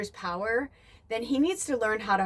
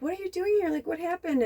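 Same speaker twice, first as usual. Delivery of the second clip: alarmed call; 10 semitones higher, faster than usual. Retold or imitated. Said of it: "I wanted to be like".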